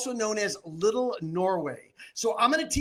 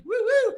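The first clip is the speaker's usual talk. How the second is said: high, loud double woo; cheering whoops; 14 semitones higher, 6 dB louder than usual. Real, not acted. Auctioneer cheering as he signs off, high whoops. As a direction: whoop to hand back the stage.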